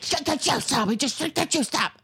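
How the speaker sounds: high, strained mock urgency; frantic mother. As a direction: imitate a mother insisting in a panic. Quoting a frantic film mother.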